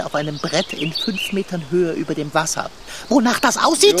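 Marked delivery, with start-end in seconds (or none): 3.1-4.0 incredulous retort. Acted.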